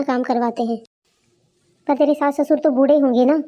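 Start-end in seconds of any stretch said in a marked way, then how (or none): none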